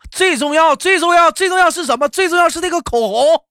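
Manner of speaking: high, loud hammed-up enthusiasm; acted eager husband fishing for approval; excited flattering husband. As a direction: build it up like a proud reveal, pitched high. Husband excitedly flatters wife in story.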